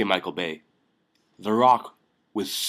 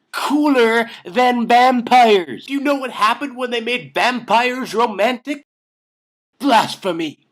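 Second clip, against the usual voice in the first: much higher and much louder, a mock-furious outburst; outraged rant; 11 semitones higher, 9 dB louder than usual. Fan ranting at Hollywood over the romantic remake, mock fury. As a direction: rail at Hollywood like a betrayed fan.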